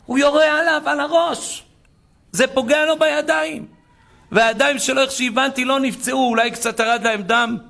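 high, urgent retelling; excited recounting. Retold or imitated. Survivor telling his mother about the bullets, excited and high. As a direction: pitch it high, amazed at surviving.

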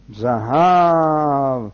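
hugely stretched "זהב"; awed drawn-out word. A narrator's reading. Narrator reveals the treasure inside, drawn-out amazement. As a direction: draw "זהב" out in wonder.

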